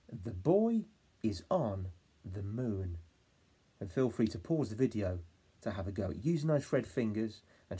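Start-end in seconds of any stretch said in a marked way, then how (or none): 0.1-3.0 put-on the boy is on the moon slowly; slow whole sentence. Shown as a demonstration. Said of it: "my turn".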